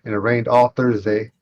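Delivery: ordinary delivery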